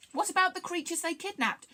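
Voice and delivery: high-pitched call of concern; worried cry. A narrator's reading. Rusin cries out as the engine starts, worried. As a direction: cry out high, anxious for others. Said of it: "a cry went up from Rusin".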